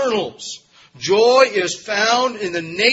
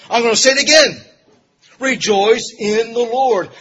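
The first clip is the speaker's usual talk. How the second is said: louder, insistent restatement of REJOICE IN THE LORD; emphatic repetition; 3 semitones higher, 7 dB louder than usual. Retold or imitated. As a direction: drive home "Rejoice in the Lord", loud and slowed. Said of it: "This is here for emphasis".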